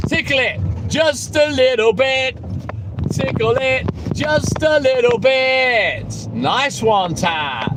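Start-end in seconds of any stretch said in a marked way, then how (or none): 3.1-6.3 drawn out slowly and teasingly; slowed right down. Shown as a demonstration. Streamer answering a viewer's request, slow teasing drawl. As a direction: stretch each word slowly, savouring it. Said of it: "Yes, Glennie".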